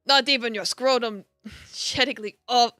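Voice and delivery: put-on Irish accent, old hag voice. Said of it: "trying to sound like an old hag with an Irish accent".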